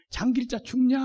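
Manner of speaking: ordinary talk, nothing marked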